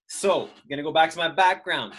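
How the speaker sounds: usual speaking voice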